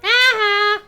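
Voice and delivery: loud high gloating aha; victorious shout; triumphant aha. Real, not acted. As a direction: crow it out in triumph. Skill lands in combat.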